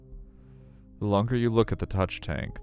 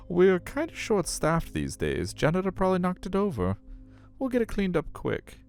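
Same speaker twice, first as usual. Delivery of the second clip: sing-song high staff voice; aquarium staffer; 12 semitones higher than usual. Acted. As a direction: put on a high voice for an official politely shooing you away. Acts out staff member explaining mess.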